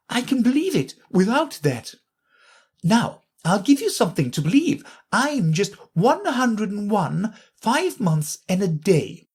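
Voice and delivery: high Queen voice, slowing on her age; Queen telling Alice her age; boasting Queen. A narrator's reading.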